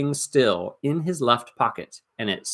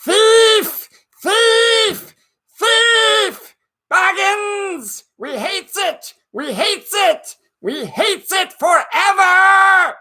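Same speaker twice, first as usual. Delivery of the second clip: much higher and loud, a creature screeching hate; shrill Gollum cry, building as it goes; Gollum's shrieking curse; 7 dB louder, much slower than usual. Acted. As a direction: scream it after the thief like a wretched cave creature. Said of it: "the voice came behind".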